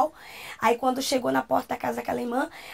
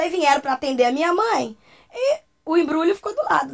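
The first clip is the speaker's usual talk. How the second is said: ordinary talk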